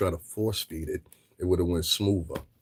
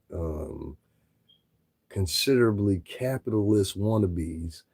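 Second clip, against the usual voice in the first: normal talking voice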